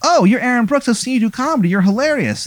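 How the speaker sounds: very high, put-on fan voice; gushing fan. Swat guy recognizes comedian Aaron, high-pitched gushing. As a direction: gush in a high character voice. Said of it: "the swat guy".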